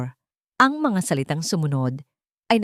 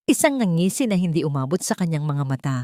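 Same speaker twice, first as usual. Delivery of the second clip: plain reading voice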